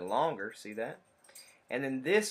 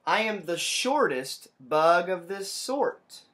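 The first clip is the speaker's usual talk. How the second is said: higher, louder, slow bragging; proud little bug boasting; short bug boasting; 5 semitones higher, 8 dB louder, slower than usual. Acted. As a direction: brag slowly and loudly like a tiny bug. Reading a bug's line.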